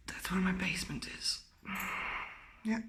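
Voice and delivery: frightened whisper. Real, not acted. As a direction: hush it, afraid of the dark. Scared in dark basement.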